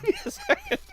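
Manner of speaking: high, breaking into helpless laughter; can barely get the words out for laughing; cracking up. Real, not acted. On a prank call to the tax man, laughing helplessly.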